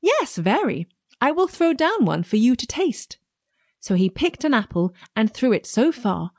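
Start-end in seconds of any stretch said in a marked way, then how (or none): 0.0-3.2 piglet voice. A narrator's reading. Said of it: "he replied at once".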